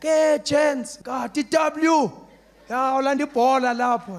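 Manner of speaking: loud, high shout to friends; calling out. Retold or imitated. Kid calling to friends under the tree, loud happy shout. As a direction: call out across the street.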